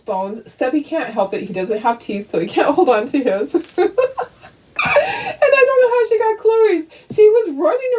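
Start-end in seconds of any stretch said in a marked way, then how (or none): 3.5-7.9 laughing. Real, not acted. Laughing about the bone-stealing dog.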